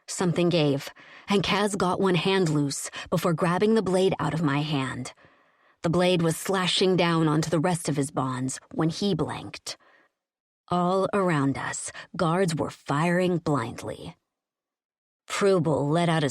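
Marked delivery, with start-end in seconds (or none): none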